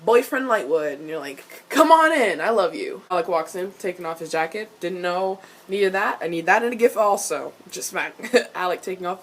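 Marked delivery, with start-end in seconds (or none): 1.7-3.0 gushing welcome. Acted. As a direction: burst out an eager mock invitation, then settle. Acting out eager welcome.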